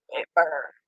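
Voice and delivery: It burns said low and soft; low soft groan. Real, not acted. Streamer on spicy saliva, groaning It burns quietly. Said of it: "it burns twice".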